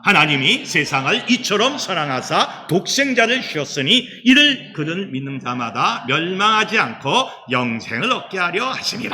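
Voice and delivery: steady unison reading aloud; measured congregational scripture reading. Acted. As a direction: read the verse together with the church.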